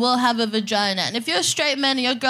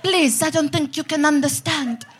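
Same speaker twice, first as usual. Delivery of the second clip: bad French accent; 3 semitones higher than usual. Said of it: "she was French".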